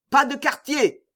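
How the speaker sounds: high-pitched merciless order; battle cry. A narrator's reading. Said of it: "cria en français". (cri de guerre, voix haute)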